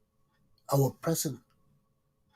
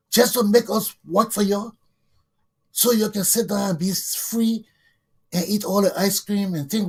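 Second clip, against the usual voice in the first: impassioned rant; 4 semitones higher, 5 dB louder than usual. Real, not acted. Directly addressing young Americans.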